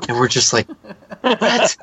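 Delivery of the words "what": high, laughing burst of disbelief; incredulous. Retold or imitated. Parents reacting to clueless son at party, high mock disbelief. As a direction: act out stunned parents, pitch jumping up.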